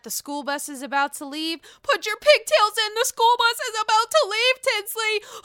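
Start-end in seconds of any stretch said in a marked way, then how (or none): 1.8-5.4 high, loud imitation of a taunting call; mock mother's call. Dorinda taunting Tinsley about the school bus, mock loud call. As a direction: call it out high as if herding a child.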